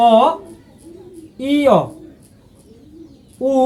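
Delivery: অ, ই, উ said one by one with pauses; Korean vowels. Shown as a demonstration. Teacher reading Korean vowels one by one to learners. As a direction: model each vowel distinctly. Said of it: "দেখেন".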